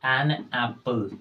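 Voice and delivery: "An apple" said very slowly, each sound drawn out; slow classroom demo of "An apple"; model pronunciation. Shown as a demonstration. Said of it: "तो क्या हो जाएगा?".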